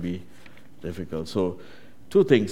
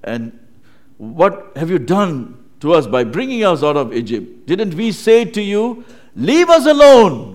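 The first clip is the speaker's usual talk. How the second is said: accusing complaint; 6 semitones higher, 9 dB louder than usual. Acted. Israelites confronting Moses over leaving Egypt, accusing complaint. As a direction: protest building to a high, loud cry. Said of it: "They were just complaining".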